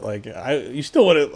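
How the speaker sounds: ordinary talk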